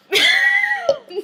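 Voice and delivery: high, loud squeal of glee; delighted laughing squeal. Real, not acted. Hearing her theory confirmed, a loud happy squeal. Delighted at open marriage plot.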